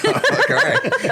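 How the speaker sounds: words squeezed out through a high laugh; cracking up mid-quote. Real, not acted. Laughing while retelling the scene.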